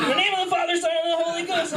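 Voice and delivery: high, strained hurried proclamation; imitated forceful baptizer. Guy pushing his head underwater, high mock proclamation. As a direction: declaim it like an overzealous baptizer.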